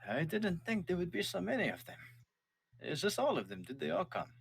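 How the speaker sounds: musing dad looking at the dragons; father's voice. Acted.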